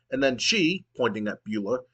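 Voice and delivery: ordinary talk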